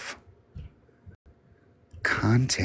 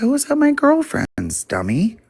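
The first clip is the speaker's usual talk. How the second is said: high, fast, louder bratty retort; mocking sister voice; 6 dB louder, faster than usual. Teller mimicking her sister brushing her off, high and fast. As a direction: toss it off like a snotty little sister.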